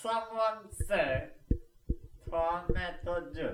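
slow, drawn-out entrance call; dramatic entrance line. Acted. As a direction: sweep in like a hero arriving.

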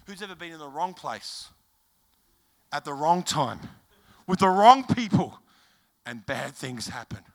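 slow, spaced-out preaching with heavy pauses; measured sermon cadence. Acted. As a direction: lay each phrase down deliberately for the crowd.

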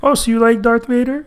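high caricature filmmaker voice; George Lucas. Retold or imitated. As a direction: dangle the question in a put-on high voice. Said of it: "Why does George Lucas always sound like that".

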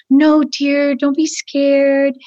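lightly voiced slow coaxing woman; mock soothing. Ghislaine calming the frightened girl, a slow mock coo. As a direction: coo it slowly, falsely soothing. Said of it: "And Ghislaine's going".

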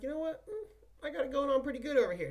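high-pitched, fast, self-satisfied imitation of a listener; hammed-up smug listener voice; mock smug listener. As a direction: squeal it out like a smug fan gloating. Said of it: "You're like".

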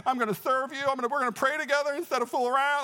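high, overwrought pleading; mock tearful promise. Boyfriend promising girlfriend to pray instead, high mock tearful plea. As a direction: imitate an emotional repentant teen, pitched high. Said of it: "I'm gonna bawl my head off".

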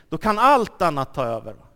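usual speaking voice

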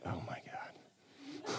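slow, whispered mock despair; eye-rolling exasperation. Jesus rolling his eyes at the crowd, whispered exasperation. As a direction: breathe it out in a weary whisper. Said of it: "just with the eye roll, like".

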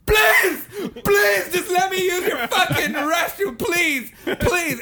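high-pitched, loud retold plea; desperate begging. Customer begging the cashier for the restroom, desperate. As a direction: beg wildly, bursting out on each please. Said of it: "I'm telling her like this".

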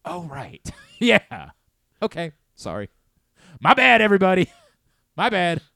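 laughing loudly through a mock on-air apology; sheepish laughing apology. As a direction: play it as caught out and laughing it off.

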